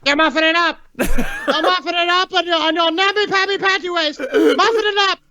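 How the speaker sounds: high-pitched screeching tirade; hammed-up, high screamed scolding; screaming girl impression. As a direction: scream shrilly like a girl mimicking her father. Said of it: "started screaming at Diana in her father's voice".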